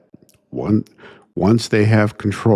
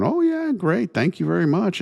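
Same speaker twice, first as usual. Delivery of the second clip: high, sing-song sarcastic thank-you; mock thanks; 10 semitones higher than usual. Speaker mocking radicals thanking their naive supporters, high sarcastic voice. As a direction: mimic them brushing you off with fake gratitude.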